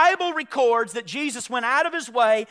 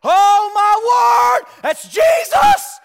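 slow, loud, much higher cry of recognition; excited shout; 11 semitones higher, 10 dB louder, much slower than usual. Retold or imitated. Disciples realise the man on shore is Jesus, amazed shout.

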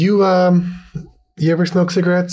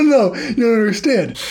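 usual speaking voice

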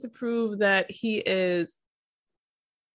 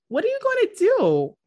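exasperated question; 12 semitones higher, 5 dB louder than usual. Real, not acted.

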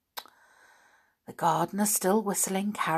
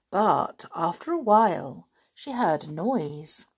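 usual speaking voice

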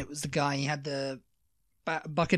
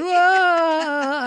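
high startled yelp; mock scream; 14 semitones higher, 9 dB louder than usual. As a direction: yelp like a scared kid. Kids screaming, running away.

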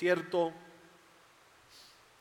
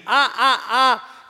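excited laugh; 7 semitones higher, 8 dB louder, much slower than usual. Real, not acted. Preacher laughing mid-sermon.

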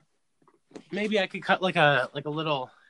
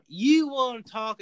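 higher, louder, drawn-out talk-show host; Doctor Phil; 5 semitones higher, 7 dB louder, slower than usual. Acted. Riffing with co-host on the talk show, drawn-out impression. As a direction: stretch it out like a TV therapist. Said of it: "That's just Doctor Phil".

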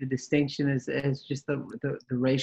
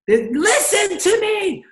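shout; 7 dB louder, slower than usual. Real, not acted.

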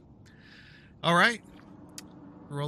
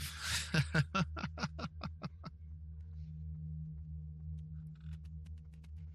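evil laugh; 10 semitones lower, 8 dB quieter than usual. Acted. Villain laughs at the party.

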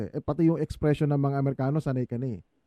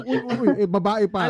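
mock-impressed hey-hey, louder and a bit higher; impressed Filipino listeners; 4 semitones higher, 4 dB louder than usual. Imitating Filipinos impressed by an English-speaking host, mock wowed. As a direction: play a wowed Filipino audience member. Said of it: "Parang mga Pilipino".

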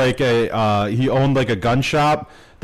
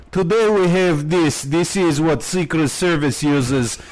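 a light mock Russian accent, pitched up; hint of a hammed-up Russian gun reviewer; fake Russian accent; 4 semitones higher than usual. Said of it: "speaking in this fake Russian accent".

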